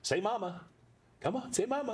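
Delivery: put-on high coaxing baby talk; high sing-song pet talk; coaxing a dog. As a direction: coax the puppy to speak. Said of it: "And I go".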